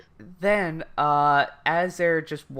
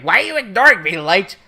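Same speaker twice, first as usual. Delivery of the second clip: higher, louder mock pleading; needy whine; 6 semitones higher, 5 dB louder, faster than usual. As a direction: whine for attention, hammed-up. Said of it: "Ryuk is just being needy".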